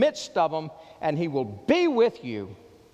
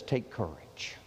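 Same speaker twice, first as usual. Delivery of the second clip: low, soft and reassuring; tender voice of Jesus; 9 semitones lower, 7 dB quieter than usual. Acted. Jesus calming the fearful, low and tender. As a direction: gently comfort the frightened. Jesus's words quoted in sermon.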